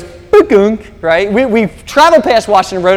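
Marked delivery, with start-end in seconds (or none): 0.0-1.0 imitated high electronic bukunk; mock GPS beep; GPS chime noise. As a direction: make the phone's alert sound with your mouth. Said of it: "the G P S goes".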